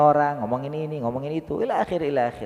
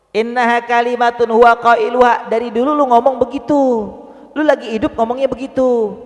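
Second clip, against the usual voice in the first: higher and louder scolding; indignant call-out, higher and louder; stern rebuke; 7 semitones higher, 5 dB louder than usual. Acted. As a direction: scold him for the old excuses. Allah rebuking the liar.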